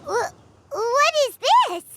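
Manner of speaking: high, startled gasp of a question. Acted. Seeing the strange bottle, high and startled. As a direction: recoil at what you see. Shocked at the find.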